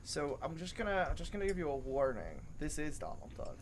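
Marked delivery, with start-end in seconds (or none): none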